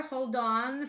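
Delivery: usual speaking voice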